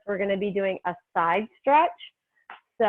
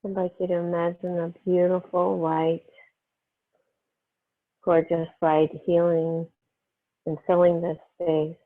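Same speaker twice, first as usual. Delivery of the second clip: calm, unhurried meditation voice with long pauses; guided meditation; 2 semitones lower than usual. Real, not acted. Yoga teacher easing students into breath and healing energy, guided meditation. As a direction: settle them gently into the breath, phrase by phrase.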